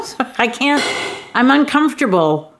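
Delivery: ordinary talk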